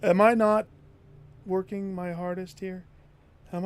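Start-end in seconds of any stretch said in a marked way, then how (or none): 0.0-0.7 furious yelling. Real, not acted. Yelling at bad luck.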